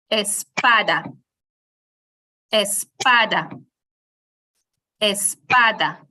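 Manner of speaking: espada with PA punched on a finger snap; stress on PA. Shown as a demonstration. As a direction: punch PA in espada, the same each time. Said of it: "snap your finger on the syllable".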